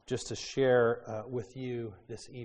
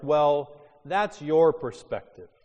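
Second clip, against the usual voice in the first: louder, higher dismissive quote; 3 semitones higher, 11 dB louder, slower than usual. Retold or imitated. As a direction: brush off the other view. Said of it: "Things like".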